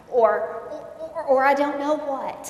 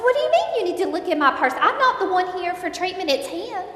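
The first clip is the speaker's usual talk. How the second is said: higher, louder, fast outraged questioning; indignant protest; 6 semitones higher, 8 dB louder, faster than usual. Retold or imitated. Wife objecting to rehab staff searching her purse, higher, louder and fast. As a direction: protest that you're not the patient.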